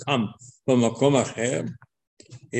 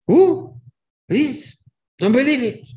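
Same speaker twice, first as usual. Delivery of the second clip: high, loud, disbelieving cry; stunned shout; 12 semitones higher, 6 dB louder, slower than usual. Acted. King jumps from chair hearing Haman.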